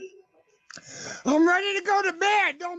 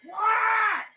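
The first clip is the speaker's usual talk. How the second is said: from another room; 4 semitones higher than usual. Real, not acted. Calling out from the bedroom.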